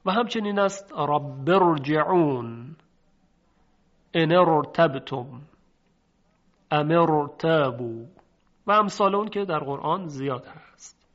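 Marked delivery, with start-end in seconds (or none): 1.0-2.8 slow رب ارجعون joined, ra full; heavy ra. Shown as a demonstration. Teacher gives further examples of unthinned ra, deliberate heavy ra. 4.1-8.0 slow, firm ان ارتبتم، ام ارتابوا with full ra; heavy ra. Shown as a demonstration. Teacher lists similar Quranic examples, slow heavy ra.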